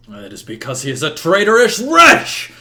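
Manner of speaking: building to a loud, high shout; angry shout. Acted. Uther denounces Merlin before the whole court, angry shout. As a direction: hurl the accusation across the hall. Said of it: "Merlin shouts".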